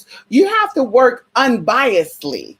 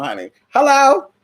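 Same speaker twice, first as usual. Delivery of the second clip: normal talking voice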